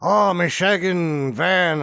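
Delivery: slow, lightly mocking Irish brogue; Irish accent. Mimicking a townie from the film in a slow Irish accent. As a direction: drawl it out in a jokey Irish accent. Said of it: "They all sound pretty Irish".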